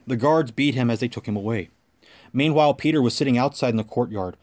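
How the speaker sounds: ordinary talk, nothing marked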